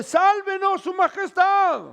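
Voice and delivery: high, loud desperate plea from below; woman crying out. Acted. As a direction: yell up to the king on the wall for help. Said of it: "una mujer le grita de abajo".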